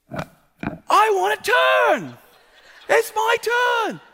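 high, drawn-out tantrum shout; imitated child's meltdown, loud and very high; little boy freaking out. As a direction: yell it slowly like a furious four-year-old. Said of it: "Fulton freaks out".

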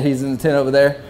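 ordinary talk

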